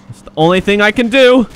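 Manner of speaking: high, loud rising cry; dramatic outburst. Pretend. Cat officer left with no choice, dramatic outburst. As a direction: burst out with mock desperation.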